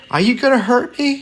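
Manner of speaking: very high, fast, scared woman; frightened cry. A narrator's reading. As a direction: whimper it out in fear. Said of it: "she cried".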